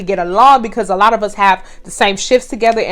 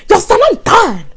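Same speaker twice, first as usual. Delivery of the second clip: high, put-on voice of the coworker; mock coworker voice; 11 semitones higher than usual. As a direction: act out the coworker tossing it off. Coworker quoted in retold fight.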